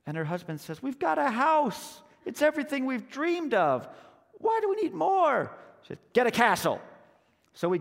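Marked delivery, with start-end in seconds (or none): 0.8-5.5 exasperated protest. Acted. Husband protests wife's demand. 6.1-6.8 loud, higher wifely order; bossy command. Acted. Wife orders husband back out.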